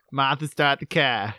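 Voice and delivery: mock Boston accent, dropped R's; hammed-up Boston drawl; ridiculous Boston accent. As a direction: play along as a Boston radio fan. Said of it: "ridiculous Boston accents on Boston sports radio".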